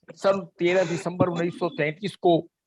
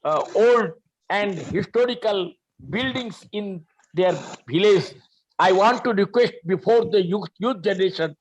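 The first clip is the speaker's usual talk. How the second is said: slow explanation; 2 semitones higher, much slower than usual. Real, not acted. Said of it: "धीरे-धीरे पढूं ताकि सभी समझ सके".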